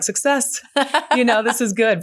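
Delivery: mock cheer. Therapist joking about her tissue-filled wastebasket, mock triumphant. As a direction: cheer over the full wastebasket as if it's a win. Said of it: "I'm like".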